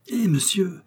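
appel aigu lancé au loin; héler un cavalier qui s'éloigne; appel crié. A narrator's reading. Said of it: "s'écria un garçon d'écurie". (le garçon d'écurie hèle l'inconnu, voix haute)

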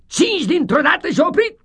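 high, loud, triumphant teacher crowing; loud gloating schoolmaster; gleeful schoolmaster shout. Acted. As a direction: crow it out like a pleased tyrant. Schoolmaster threat.

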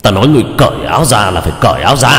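a lightly voiced icy threat; giọng Đạo Phong. Shown as a demonstration. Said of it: "giọng nói nghe rất là lạnh lùng". (giọng lạnh lùng, đe dọa, to dần)

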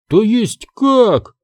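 put-on high, drawn-out girl's outcry; startled high question; indignant cry. A narrator's reading. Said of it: "крикнула Сабрина".

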